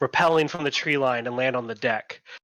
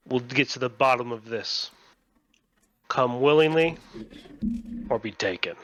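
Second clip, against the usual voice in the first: spaced-out, controlled threat with long pauses; stern authority figure; 2 semitones lower than usual. Acted. Man on shore gives Banzai an ultimatum, measured and stern.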